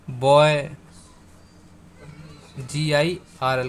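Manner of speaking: usual speaking voice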